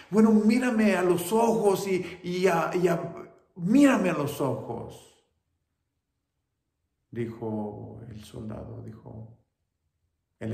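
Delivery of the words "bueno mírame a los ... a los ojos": high, loud urging, stumbling over words; mother begging. Acted. Mother urges her blinded son to look at her, loud. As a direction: plead loudly and high.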